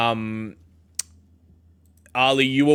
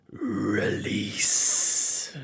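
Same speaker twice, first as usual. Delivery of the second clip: deep booming voice. Acted. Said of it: "in that deep, booming voice".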